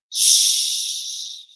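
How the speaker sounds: loud breathy hiss; dragon hiss. Acted. Dragon answers the little girl with a hiss.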